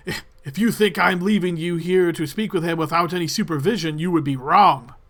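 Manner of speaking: loud, defiant refusal; stubborn guard. Acted. Guard refusing to leave the party alone, loud and defiant. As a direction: plant your feet and refuse them. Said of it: "he says".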